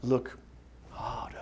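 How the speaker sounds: quiet, fading to a breath; mild Rafiki echo; soft mock echo. Shown as a demonstration. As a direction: hush it like the baboon. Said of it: "listen to Rafiki".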